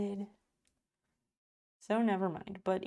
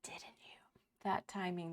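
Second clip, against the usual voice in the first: whisper; 6 dB quieter than usual. Real, not acted. Whispered accusation to chat.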